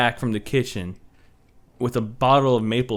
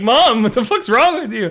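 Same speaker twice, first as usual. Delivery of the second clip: panicked yelling; 11 semitones higher, 6 dB louder than usual. Retold or imitated. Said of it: "and I'm like".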